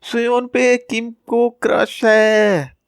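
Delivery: high, slow taunting chant; sing-song it out to humiliate him; mocking group shout. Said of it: "सब चिल्ला के बोलने लगते हैं".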